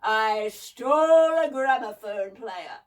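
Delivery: loud, slow, booming boast; proud mechanical monster, drawn out; boasting crackly giant. A narrator's reading. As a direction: brag it out in a big crackly voice. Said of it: "boasted the voice".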